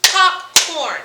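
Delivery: two-beat popcorn, split in the middle; clapped syllables. Shown as a demonstration. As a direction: POP and CORN as two punched beats. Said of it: "Clap it".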